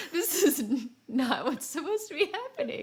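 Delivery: high, laughing protest; laughing disbelief. Retold or imitated. Gamer laughing at Batman gliding off wrong, high and laughing. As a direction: blurt it out through laughter. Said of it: "I was like".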